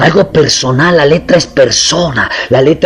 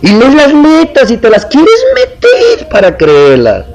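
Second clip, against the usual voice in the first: higher, impassioned preacher voice; fervent preaching; 9 semitones higher than usual. Acted. Pastor exhorts his congregation, fervent preaching. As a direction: preach it out with fervour.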